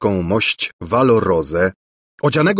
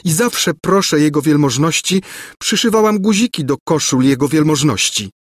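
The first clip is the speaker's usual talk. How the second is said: lightly voiced shy servant girl, higher; innocent maid; 6 semitones higher than usual. A narrator's reading. Blushing maid to the prince, innocent. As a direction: say it with naive eagerness. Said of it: "dodało niewinne dziewczę".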